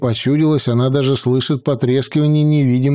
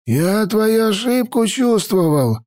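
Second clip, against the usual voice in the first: put-on old mage voice; 8 semitones higher than usual. A narrator's reading.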